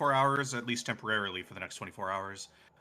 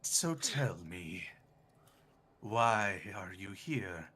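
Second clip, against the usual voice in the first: slow, low, quiet creepy voice; lightly voiced eerie presence; 4 semitones lower, 6 dB quieter, slower than usual. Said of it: "you hear it go".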